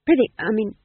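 flustered jester catching himself; flustered bumbling goofy. Acted. Fool corrects himself.

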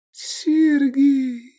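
put-on high, faint, affectionate girl's voice; weak, tender murmur pitched high; tender whisper. A narrator's reading. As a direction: breathe his name lovingly, drawn out. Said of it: "прошептала она ласково".

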